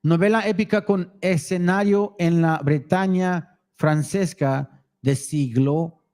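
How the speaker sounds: reading the Spanish text aloud; steady Spanish read-through; reading foreign language. Real, not acted. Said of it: "read it in Spanish".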